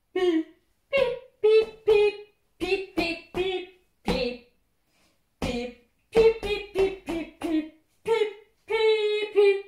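choppy, high robot beeps. Shown as a demonstration. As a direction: beep it out like a robot. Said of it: "wie ein Roboter singen".

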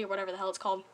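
usual speaking voice

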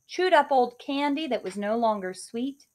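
ordinary delivery, nothing marked

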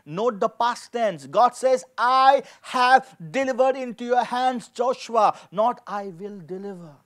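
normal talking voice

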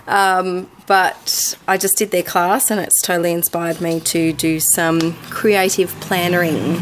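usual speaking voice

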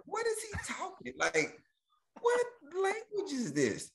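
high, incredulous questions piling up; baffled mock outrage. Moviegoer mocking an actor's accent, high and incredulous. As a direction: squeak out disbelief at the accent.

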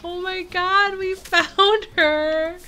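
high, loud, emotional gush; overjoyed, near tears. Real, not acted. Player who just found her dream villager, overjoyed. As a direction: voice pushed up high with happy disbelief.

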